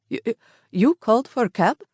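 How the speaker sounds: put-on high, stammering driver with a thick accent; stuttering cab driver. A narrator's reading. Cab driver stuttering at the battered woman. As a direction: stammer it out in shock. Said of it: "he began to stutter".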